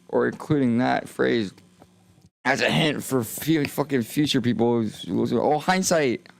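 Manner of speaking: normal talking voice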